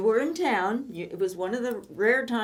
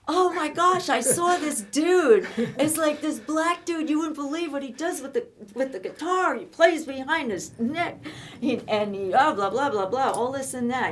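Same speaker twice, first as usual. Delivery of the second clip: higher, louder, breathless fan raving; imitating the boyfriend raving about a concert, higher and louder; gushing boyfriend; 5 semitones higher, 5 dB louder than usual. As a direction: rattle off his amazement at the guitarist. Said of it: "And you go".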